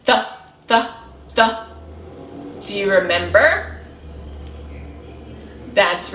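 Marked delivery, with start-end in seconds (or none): 0.0-1.6 "Th, th, th" as short separate sounds; isolated th sound. Shown as a demonstration. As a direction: repeat "th" alone, with gaps between. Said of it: "What letter are you hearing".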